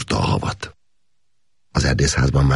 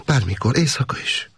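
higher, eager offering; eager woman; 9 semitones higher than usual. A narrator's reading. Adrien promises to come any time, even at night. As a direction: reassure him warmly, eager to help. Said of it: "mondta a nő".

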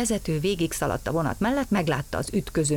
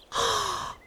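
a sharp high gasp of realisation; startled gasp. Acted. Gombóc Artúr remembers it's autumn, startled gasp. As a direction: gasp as it suddenly dawns on him.

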